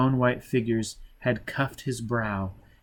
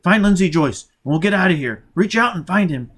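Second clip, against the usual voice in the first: loud, higher, driving shouted orders; furious barked orders; angry commands; 7 semitones higher, 6 dB louder than usual. Acted. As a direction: force the child to obey. Foyle shouting at child.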